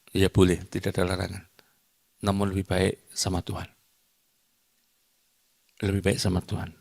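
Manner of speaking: usual speaking voice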